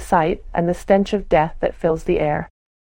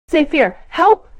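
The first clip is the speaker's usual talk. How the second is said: high, loud panicked cry; crying out for help; 9 semitones higher, 4 dB louder than usual. A narrator's reading. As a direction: yell for help in fear. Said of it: "she cries out".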